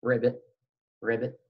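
put-on a teacher's playful frog noise for kids; frog croak ribbit. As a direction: croak it gently like a little frog. Imitating the frog for children.

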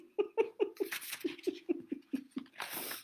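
airy squeaky twin giggle; a quick high giggle; giggling twins; high breathy giggle. Acted.

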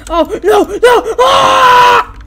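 high, loud cries rising into a scream; plead, then scream in pretend panic; mock terror. Player voicing a victim about to be killed, panicked wail.

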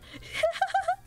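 high-pitched burst of laughter; real laugh. Real, not acted. Streamer laughing at chat's memes, high giggle.

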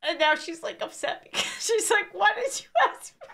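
tearful, squeaky real laughter; fading out breathless with laughter; crying laughing. Real, not acted. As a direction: squeeze the words out through laughs. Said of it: "I was actually crying laughing".

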